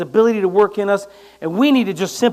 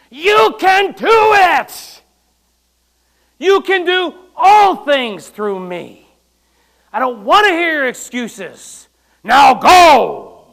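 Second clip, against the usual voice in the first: slow, much higher, loud yell; impatient yell; 5 semitones higher, 9 dB louder, much slower than usual. Retold or imitated. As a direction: yell it at a reluctant servant. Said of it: "he has to yell something at you".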